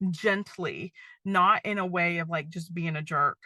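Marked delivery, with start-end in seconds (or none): none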